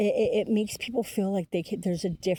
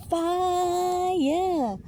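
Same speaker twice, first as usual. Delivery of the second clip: excited; 12 semitones higher, 5 dB louder than usual. Real, not acted. Exclaims about the eclipse energy.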